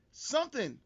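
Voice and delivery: ordinary delivery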